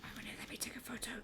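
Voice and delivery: fast unvoiced whisper; hushed frustrated question. Real, not acted. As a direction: whisper it quickly to yourself. Muttered question.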